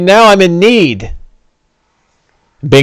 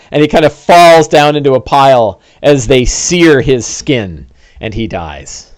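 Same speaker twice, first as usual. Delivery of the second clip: ordinary talk, nothing marked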